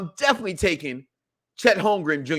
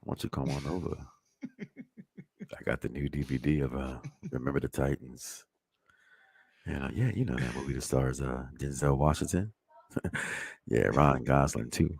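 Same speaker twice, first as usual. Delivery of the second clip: very low, soft seducer voice; deep, hushed come-on to a date; Chris Pratt; 8 dB quieter than usual. Acted. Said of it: "let me try to sound like Chris Pratt".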